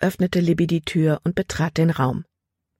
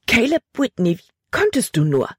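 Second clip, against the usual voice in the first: higher, indignant outcry; girl scolding the boy who scared her, exclaiming; exclaimed; 6 semitones higher than usual. A narrator's reading. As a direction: fling the reproach at him. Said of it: "rief sie aus".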